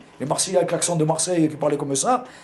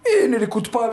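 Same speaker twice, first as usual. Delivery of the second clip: mother reassuring; 8 semitones higher, 4 dB louder than usual. Retold or imitated. Mother answering her son.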